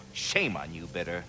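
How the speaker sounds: ordinary delivery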